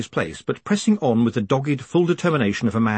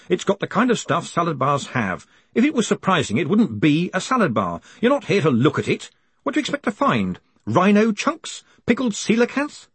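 higher-pitched, exasperated buffet diner; indignant grumbler at the salad bar; 7 semitones higher than usual. A narrator's reading. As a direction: complain in a raised pitch, question after question.

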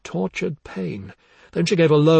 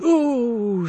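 descending wail; 9 semitones higher than usual. Acted. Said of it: "a long descending".

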